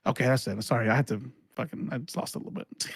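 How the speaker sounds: low, soft, fading almost to nothing; subdued apology. Real, not acted. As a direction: come down from a rant, trailing into breath. Winding down, apologizing after the rant.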